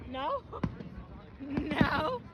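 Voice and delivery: normal talking voice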